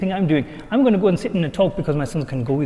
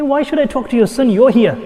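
higher, louder exasperated retort; annoyed retort; 6 semitones higher, 5 dB louder than usual. Retold or imitated. Telling the father at the madrasa, exasperated and higher. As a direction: push the job back at him.

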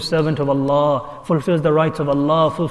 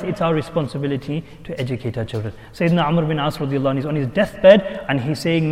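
normal talking voice

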